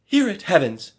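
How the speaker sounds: high, loud rapturous cry; enraptured cry. A narrator's reading. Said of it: "cried the enraptured maid".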